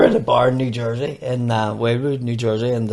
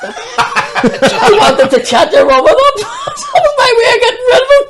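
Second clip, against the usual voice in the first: high, loud shrieking laughter; helpless laughing fit; crying with laughter; 8 dB louder than usual. Real, not acted. Punchline, laughter turning to shrieks.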